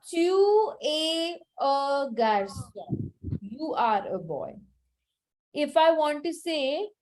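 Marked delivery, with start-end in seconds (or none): 0.1-3.1 very slow, each word laid out: Tu, es, un, garçon; slow French model. Shown as a demonstration. Teacher modelling French for a student, very slow and deliberate. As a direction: spell the sentence out by sound, very slowly. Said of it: "I will say".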